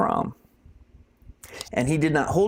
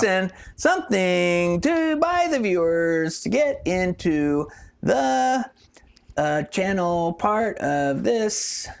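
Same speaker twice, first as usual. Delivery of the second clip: slow, drawn-out words with long gaps; halting, stretched-out vamping; trailing off; 4 semitones higher, slower than usual. Real, not acted. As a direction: lose the thread and stretch each word. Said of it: "He's trailing off".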